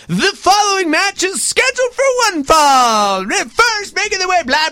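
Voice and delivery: imitating the wrestling ring announcer. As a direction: announce it like the arena PA. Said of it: "you'd be waiting".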